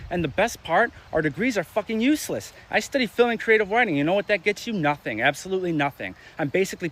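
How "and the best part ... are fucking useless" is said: normal talking voice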